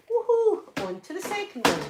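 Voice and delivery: high, bright woohoo of triumph; celebratory high-pitched whoop; gleeful cheer. Real, not acted. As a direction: whoop as one job gets finished. Celebrates finishing one basket.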